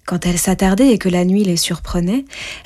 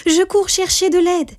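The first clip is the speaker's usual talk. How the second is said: put-on much higher, bright eager girl's cry; eager exclamation; 11 semitones higher than usual. A narrator's reading. Rose Rouge offers to fetch help for the dwarf, eager. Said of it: "s'exclama Rose Rouge".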